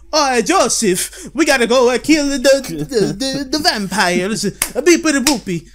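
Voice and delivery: high, loud, slow cartoon Italian accent; mock Italian accent. Caesar urging Joseph to kill vampires, overdone Italian accent. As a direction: ham up the dub's Italian accent. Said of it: "But in English, he's like".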